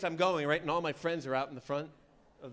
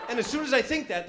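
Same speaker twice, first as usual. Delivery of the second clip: ordinary delivery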